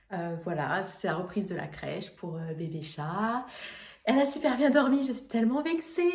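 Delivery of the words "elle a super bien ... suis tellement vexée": mock vexed. Real, not acted. Mock offence at baby sleeping.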